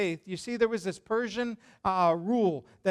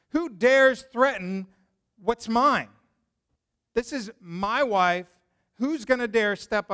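louder, slow, proud challenge; possessive king; 3 semitones higher, 7 dB louder, slower than usual. Retold or imitated. King outraged someone threatens his wife, slow and loud. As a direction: claim her like property, outraged.

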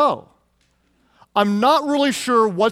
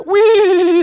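put-on much higher, louder childlike squeal; gleeful wee; 11 semitones higher, 7 dB louder than usual. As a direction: shriek with delight. Sermon mimics child's glee.

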